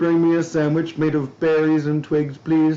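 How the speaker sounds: high, put-on voice calling to honey; mock domestic caveman. Mock cosy caveman domestic scene.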